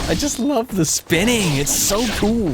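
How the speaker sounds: high, drawn-out gushing; delighted. Real, not acted. Player delighting in a spinning attack, high gushing.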